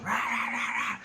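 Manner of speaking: high mock barks; imitated dog barking. Man expecting the dog to start barking, imitated barks. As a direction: bark rapidly like a hound. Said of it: "start barking".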